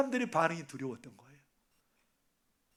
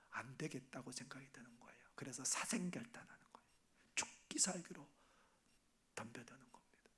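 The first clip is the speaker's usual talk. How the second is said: hushed whisper, much softer and lower; 7 semitones lower, 20 dB quieter than usual. Acted. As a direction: drop to a tense whisper. Dramatic hush in sermon. (낮게 속삭이는 설교)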